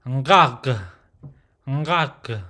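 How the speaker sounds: "ngag" nasal, then shut in the throat; nasal start on "ngag", throaty ending; closed syllable. Shown as a demonstration. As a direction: close "ngag" on a guttural stop. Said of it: "for the closed syllable is ngag".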